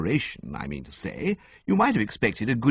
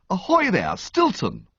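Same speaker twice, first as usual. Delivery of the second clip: high, loud hail across the shop; cried out; 9 semitones higher, 3 dB louder than usual. A narrator's reading. Bertie hailing his old school friend, loud cheerful call. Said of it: "I cried".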